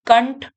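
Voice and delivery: "कंठ" as kanth with tongue-curled th; contrasting nasal sounds. Shown as a demonstration. Teacher showing pupils the difference, retroflex ending in "कंठ". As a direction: curl the tongue back on "कंठ". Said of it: "ये आप इस तरीके से".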